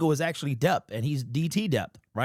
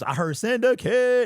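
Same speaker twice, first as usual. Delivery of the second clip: mock stadium yell; 8 semitones higher, 4 dB louder, slower than usual. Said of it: "individual yelling throughout the stadium".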